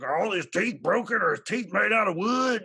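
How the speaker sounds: put-on high, loud, off-the-wall silly kid voice; a goofball kid making up nonsense questions; ridiculous silly voice. Son asking about daddy's teeth in a loud goofy voice. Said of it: "being very ridiculous".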